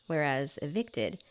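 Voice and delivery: ordinary delivery, nothing marked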